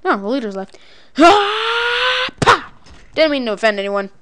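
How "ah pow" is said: high held "ah" into a loud pow; battle wail. Pretend. Player attacking the last leader, mock battle cry. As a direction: wail high, then punch the word. Striking enemy in game.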